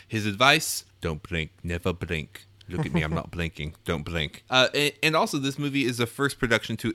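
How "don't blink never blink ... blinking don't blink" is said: put-on British actor's Cockney-style accent; lightly imitated British accent, a bit lower; Michael Caine. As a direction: advise like an old London film star. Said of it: "by Michael Caine on the set".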